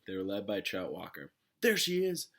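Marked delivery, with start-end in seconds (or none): 1.6-2.3 high, loud accusing shout; angry ringleader shouting over the crowd. A narrator's reading. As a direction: point her out to the mob. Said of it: "Trout shouted".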